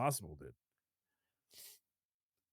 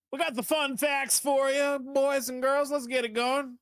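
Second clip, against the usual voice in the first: hype announcer; 7 semitones higher than usual. Acted. Announcing fun facts segment.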